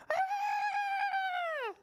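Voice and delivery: high, loud scream held long. Acted. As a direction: scream out even bigger and hold it. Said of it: "His mother screamed louder".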